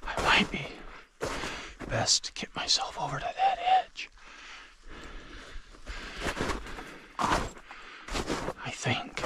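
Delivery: hushed whisper, hiding in cover; whispering while hiding. Real, not acted. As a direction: whisper so the birds won't hear. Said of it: "I don't know that I'm any quieter this way".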